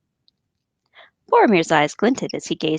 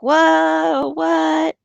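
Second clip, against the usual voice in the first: high, shocked whoa, what; surprised whoa; 7 semitones higher than usual. Retold or imitated. Everyone at the council reacts, shocked and high. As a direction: gasp it out in surprise, pitched up. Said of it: "everyone's like".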